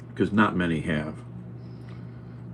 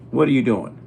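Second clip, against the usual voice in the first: loud, higher mock scold; scolding the cat; 5 semitones higher, 6 dB louder than usual. Real, not acted. Scolding the cat caught in the food, loud and higher. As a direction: call the cat out, louder.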